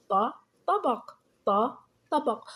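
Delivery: ط alone as "ta", then the whole طبق; sounding out with fatha. Shown as a demonstration. Teacher showing fatha at word start, ط then طبق. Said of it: "انظروا كيف ألفظ بحركة الفتح".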